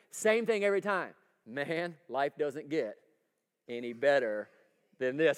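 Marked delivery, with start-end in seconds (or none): 1.4-5.4 lower, drawn-out campfire beer-commercial voice; a light take on a satisfied outdoorsman by the fire; manly beer-ad guy. Retold or imitated. Pastor quoting the old beer ad's manly men, lower and slow. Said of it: "what they would say".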